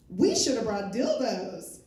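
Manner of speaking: loud, drawn-out party holler; imitating a drunk woman's shouted idea; drunken outburst. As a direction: blurt it slowly to the whole room. Retold drunk woman's sudden outburst.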